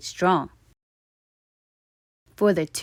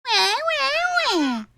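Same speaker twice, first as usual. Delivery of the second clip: high wailing wah; baby crying. A narrator's reading. Baby teeth cry at the tooth's loss, high wailing. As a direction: bawl like tiny babies. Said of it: "cried the baby teeth".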